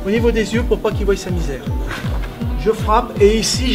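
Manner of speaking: ordinary delivery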